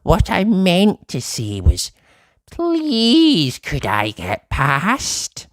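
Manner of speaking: high, very slow small voice; tiny apologetic Uppity. Acted. Shrunken Uppity apologising to the boy, high and slow. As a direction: apologise humbly from ball size.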